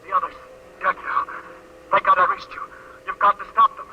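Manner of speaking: ordinary talk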